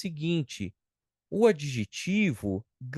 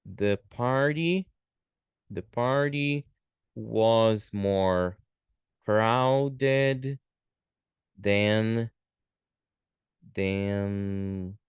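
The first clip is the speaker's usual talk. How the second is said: very slow, stretched words with pauses; slow dictation; 4 semitones lower, much slower than usual. Shown as a demonstration. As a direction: dictate it word by word. Teacher writing out example sentence.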